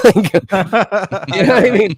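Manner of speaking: laughing. Real, not acted. Laughing at his own joke.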